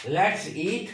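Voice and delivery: "Let's eat" rising into a pause; "Let's eat" as its own phrase; pause for the comma. Shown as a demonstration. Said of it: "comma".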